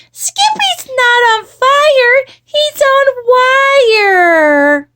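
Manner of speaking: high, loud, slow whine; little sister. A narrator's reading. Little sister correcting mama, high drawn-out wail. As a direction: wail it out, stretching the last word. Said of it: "repeated Jilly Boo".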